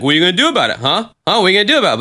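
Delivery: high, loud taunting crow. Retold or imitated. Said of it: "That's my crow voice".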